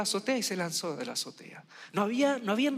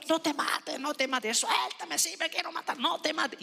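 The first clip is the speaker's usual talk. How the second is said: pleading aunt; 9 semitones higher than usual. Retold or imitated. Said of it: "Y mi tía lo agarró".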